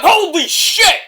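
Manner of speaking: high, loud stunned "holy shit, what"; shocked yell. Retold or imitated. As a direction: yelp it out in disbelief. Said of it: "I'm like".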